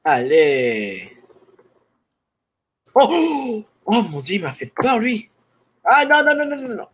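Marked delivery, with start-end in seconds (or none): 2.9-6.9 high frightened yelps; scared horror game streamer. Real, not acted. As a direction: squeal in panic at the scare. Zombie jump scare in game.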